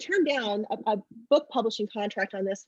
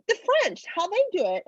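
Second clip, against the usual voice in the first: put-on much higher, earnest little kid voice; proud six-year-old; 9 semitones higher than usual. Amber showing her sister how the French kiss, proud. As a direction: explain it like it's obvious. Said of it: "she's like".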